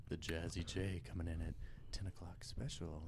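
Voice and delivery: low, whispered late-night radio voice; sexy late-night DJ. Acted. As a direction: seductive after-hours host, dropped low. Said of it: "sex operator voice".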